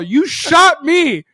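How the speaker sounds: high, loud, drawn-out accusation; indignant accusation. Retold or imitated. Retelling protest to the teammate who shot him, indignant.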